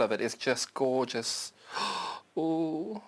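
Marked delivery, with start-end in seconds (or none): 1.7-2.3 soft awed gasp. Real, not acted. Awed by solid fabric.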